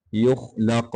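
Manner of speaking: युखलक with thick ख़ and bounced क़; heavy qaf and kha. Shown as a demonstration. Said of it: "क़ाफ ख़ा को मोटा करेंगे".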